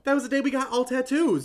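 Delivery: high, loud burst of recognition; excited outburst. Real, not acted. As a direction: blurt it out, suddenly remembering. Friend remembers the tattoo day.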